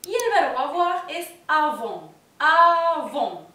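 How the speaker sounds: "avons" with a nasal o and silent s; French avons. Shown as a demonstration. Teacher stressing the plural form: avons, nasal end. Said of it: "La S final tampoco se pronuncia".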